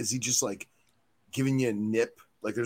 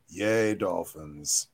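slow, drawn-out sarcastic cheer; mock the flat cheer, slowly; mock enthusiasm; 4 semitones lower, much slower than usual. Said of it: "such enthusiasm".

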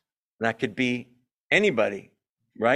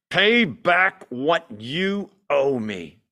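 slow, hard demand; much slower than usual. A narrator's reading. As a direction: press him slowly for the money. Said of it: "he demanded".

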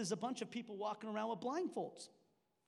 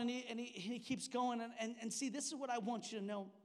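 normal talking voice

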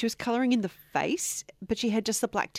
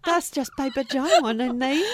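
higher, louder put-on drawling accent; 5 semitones higher, 5 dB louder, slower than usual. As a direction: ham up a drawling mock accent while laughing. Put-on accent, later asked "What accent?.

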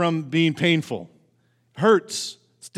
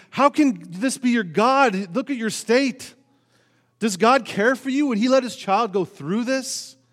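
higher, louder mocking taunt; mocking enemies; 6 semitones higher, 3 dB louder than usual. Preacher voicing David's enemies jeering at his suffering, higher and mocking. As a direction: taunt him like a scornful enemy. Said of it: "His enemies may have been mocking him".

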